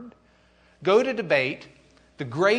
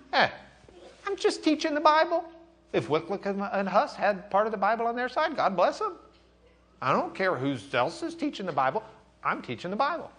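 higher, breezy dismissive reply; shrugging Luther; 7 semitones higher than usual. Pretend. Teacher voicing Luther shrugging off Eck, higher and casual. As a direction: brush it off casually.

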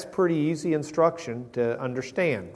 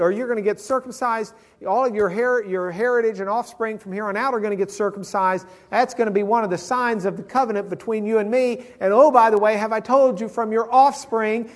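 a lightly raised, louder God proclaiming; 7 semitones higher, 4 dB louder than usual. Acted. God telling Abraham the covenant, lightly proclaimed. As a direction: announce it like a cheerful decree.

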